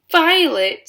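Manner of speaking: very high, loud scream of a name. A narrator's reading. Mother screams her daughter's name, high and loud. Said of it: "screamed Missus Beauregard".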